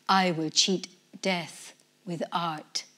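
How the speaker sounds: ordinary delivery, nothing marked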